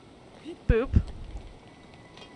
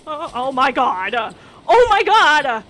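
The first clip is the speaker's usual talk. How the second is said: loud, much higher wail of dismay with a laugh; laughing shout of horror at the game; dismayed outcry; 7 semitones higher, 14 dB louder, slower than usual. Real, not acted. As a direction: cry out as it all goes wrong. Game goes badly wrong.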